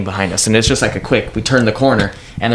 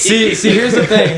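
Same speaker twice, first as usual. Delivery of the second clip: laughing; 10 semitones higher than usual. Real, not acted. Laughing while starting his point.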